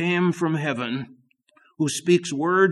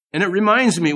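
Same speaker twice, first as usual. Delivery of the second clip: normal talking voice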